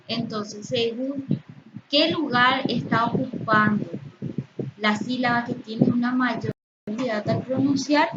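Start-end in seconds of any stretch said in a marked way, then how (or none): none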